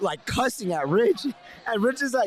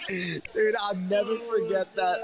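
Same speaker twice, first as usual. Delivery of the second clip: ordinary talk, nothing marked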